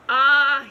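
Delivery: loud, satisfied "Ah"; contented sigh. A narrator's reading. The small pig, at last finding mud, a loud contented sigh. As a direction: sigh with relief.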